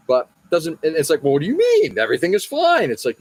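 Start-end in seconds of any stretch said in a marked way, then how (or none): 1.2-2.9 high, feigned innocent shrug; mock innocent traders. Temple traders answering Jesus, high mock innocence. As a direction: play dumb in a high voice.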